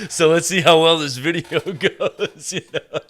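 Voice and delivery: words through real laughter; laughing. Real, not acted. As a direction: laugh while talking. Laughing at own joke.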